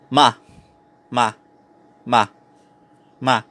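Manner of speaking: MA short with pitch falling; "ma" as a brief falling syllable; fourth tone. Shown as a demonstration. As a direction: drop "ma" quickly downward. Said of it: "the pitch starts high and falls down".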